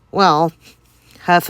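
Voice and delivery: ordinary talk, nothing marked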